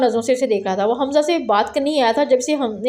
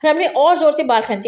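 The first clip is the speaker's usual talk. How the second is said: ordinary delivery, nothing marked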